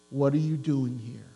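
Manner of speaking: hushed, gentle asking of the question; soft gentle voice. Shown as a demonstration. Preacher voicing God's gentle whisper to Elijah, hushed. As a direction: ask it softly, as the gentle whisper. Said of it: "Elijah heard a gentle whisper".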